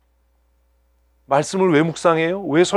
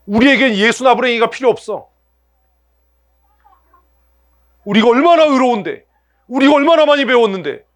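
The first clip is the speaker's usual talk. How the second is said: smug boast; 5 semitones higher, 3 dB louder than usual. Acted. Arrogant Pharisees boasting.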